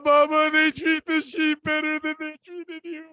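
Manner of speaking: tearful, wailing appeal to a father; grieving cry. Retold or imitated. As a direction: cry out to your father in mourning. Grieving son cries to his father.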